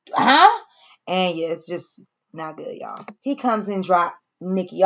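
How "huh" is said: high, louder, rising huh; mock disbelief, pitched way up; baffled huh. Reacting for Tariq to shocking news, baffled.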